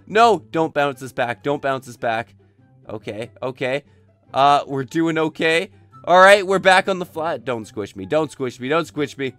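ordinary talk, nothing marked

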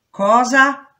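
sudden high, loud cry of disbelief; shocked shout. A narrator's reading. Pregnant prisoner shouting at the prison doctor, shocked. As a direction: shout it out in alarm.

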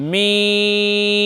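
মিম with a long held vowel, closing on the dry part of both lips; lips-together meem. Shown as a demonstration. Teacher shows learner the Arabic letter meem, long careful মিম. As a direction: stretch মিম out slowly, ending in a hummed m. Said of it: "দুই ঠোঁটের শুকনা স্থানে লাগিয়ে".

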